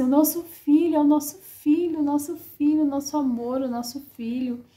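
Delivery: high, repeated exclamation easing down by the end; re-enacted tearful joy. Both parents crying over their newborn son, re-enacted joyful wonder. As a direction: start high in acted-out wonder, then let it settle. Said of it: "eu chorei também".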